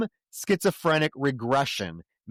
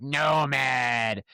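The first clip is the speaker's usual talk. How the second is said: demon voice. Retold or imitated. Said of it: "She's full demon".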